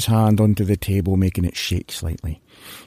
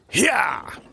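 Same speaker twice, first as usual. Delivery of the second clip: driver's hyah; 12 semitones higher, 4 dB quieter than usual. Acted. Driver urges horses.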